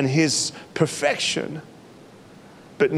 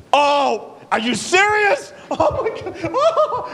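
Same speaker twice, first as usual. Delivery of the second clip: much higher, stunned and laughing; high-pitched gasp of amazement; overwhelmed disbelief; slower than usual. Retold or imitated. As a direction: squeal it out, barely believing it. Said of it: "I'm gonna be like".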